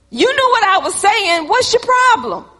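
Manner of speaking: high, indignant retort; irritated comeback. Retold or imitated. Acted irritated reply to correction.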